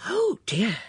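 high, gasping flutter of dismay; gasped. A narrator's reading. Witness suddenly asked for her alibi, gasping. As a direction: gasp it, taken aback. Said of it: "gasped Miss Murgatroyd".

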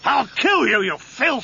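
very high-pitched burst of fury; enraged threat. Acted. Brother threatening the man who insulted the dead, enraged threat. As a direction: lunge at him vocally, pitch shooting up.

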